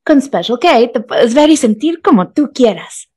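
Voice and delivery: lilting TV-commercial delivery; commercial voiceover. Shown as a demonstration. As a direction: sell it like a polished brand spot. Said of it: "the voice of Special K".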